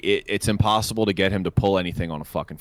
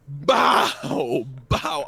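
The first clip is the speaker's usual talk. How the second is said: hammer bang imitation; 8 semitones higher than usual.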